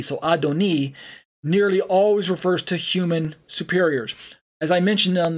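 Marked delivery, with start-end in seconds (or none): 0.2-0.9 "Adoni" with a final "ee"; Adoni pronunciation. Shown as a demonstration. Teacher joining Adon plus E, Adoni pronunciation. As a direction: finish "Adoni" on a long E. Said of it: "pronounced as Adon plus E".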